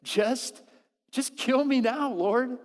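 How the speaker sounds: high, loud and anguished, begging to die; despairing plea. Retold or imitated. Jonah begs God to end his life, anguished and loud. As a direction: cry out to the Lord in despair. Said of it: "he's extremely upset".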